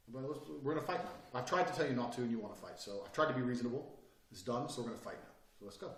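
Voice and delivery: quiet, low reasonable menace; sudden calm. Acted. Man accepting a fight with attackers, calm. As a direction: state it softly, done arguing. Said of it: "I'll change my body language completely".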